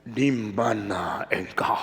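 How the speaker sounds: slow, low, whispered with a sob; tearful whisper. Retold or imitated. God asks Adam, whispering through tears. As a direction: ask it brokenly, near tears. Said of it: "Tuhan bilang".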